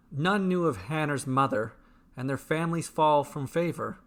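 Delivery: plain reading voice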